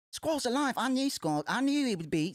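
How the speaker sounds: high-pitched, relieved character voice; acted relief.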